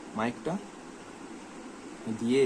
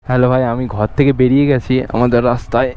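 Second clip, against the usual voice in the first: on a bumpy road; 4 semitones lower, 13 dB louder than usual. Real, not acted. Riding motorbike outdoors, mic wind.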